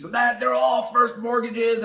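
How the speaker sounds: hammed-up detective laying down the fact; insistent detective.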